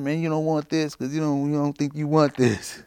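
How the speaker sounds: high, loud put-on voice sweet-talking a buddy; mock coaxing friend. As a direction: ham up talking him out of the drugs. Retells coaxing his dying friend.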